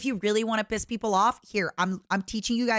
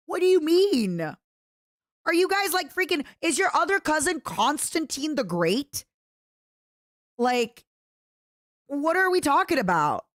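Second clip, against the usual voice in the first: high mock outrage; 5 semitones higher than usual. Real, not acted.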